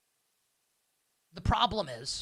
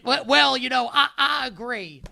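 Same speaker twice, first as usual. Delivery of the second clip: higher-pitched imitation of a Southern radio host; mock Southern accent; 6 semitones higher, 4 dB louder than usual. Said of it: "He has a Southern accent".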